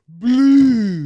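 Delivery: put-on loud high childish cry; mocking child. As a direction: blurt it like a dim child. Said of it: "points to the sky and says".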